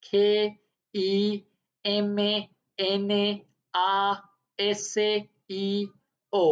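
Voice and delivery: each letter drawn out slowly; slow, careful letter-by-letter spelling; spelled out slowly. Shown as a demonstration. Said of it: "जैसे कि खिमनासियो".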